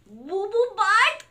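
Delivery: slow, high, loud BOO BOO BUTT, rising as a question; incredulous repeat. Acted. Reader repeats name in disbelief.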